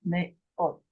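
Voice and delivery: מאות with the final sound almost a T; soft ending like a T on מאות; hebrew pronunciation. Shown as a demonstration. Teacher showing her student a T-like ending. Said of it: "You say it almost as if it is a T".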